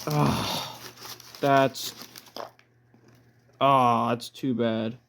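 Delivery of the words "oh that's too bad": ordinary talk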